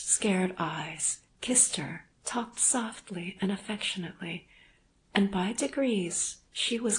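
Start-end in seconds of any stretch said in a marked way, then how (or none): none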